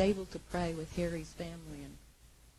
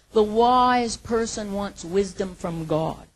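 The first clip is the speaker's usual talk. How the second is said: emphatic preaching; 3 semitones higher, 9 dB louder, slower than usual. Acted.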